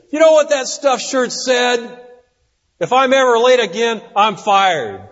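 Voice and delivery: hammed-up resentful retelling to pals; indignant griping. Employee ranting to coworkers about his boss, mock indignant.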